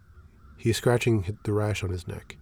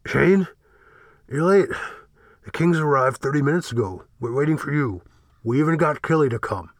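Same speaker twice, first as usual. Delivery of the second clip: muffled, louder and higher, through a door; 6 semitones higher, 6 dB louder than usual. Acted. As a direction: call out from behind a closed door. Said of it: "Fel says in a muffled voice behind the door".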